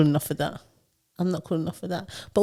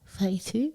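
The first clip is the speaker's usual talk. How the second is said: "Thirty-two" softly, as a hesitant question; imitated unsure question; 11 dB quieter, slower than usual. Mimicking juniors answering their manager, "Thirty-two" said like a question. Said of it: "they say it like a question".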